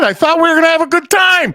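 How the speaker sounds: high, shouted mock disappointment; calling after women walking away, mock hurt. Bouncer to women leaving, high mock shout.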